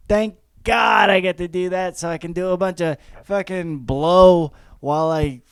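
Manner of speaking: ordinary talk, nothing marked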